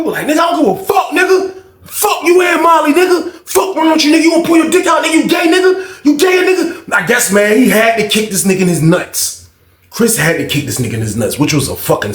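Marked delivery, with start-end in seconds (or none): 0.3-6.9 furious shout. Retold or imitated. Chris yelling at the guy, furious shout. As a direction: rage at him.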